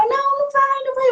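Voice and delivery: high, pleading cry; desperate plea. Retold or imitated. Woman begging fiancé in a dream, crying. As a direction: beg him not to leave, voice going high.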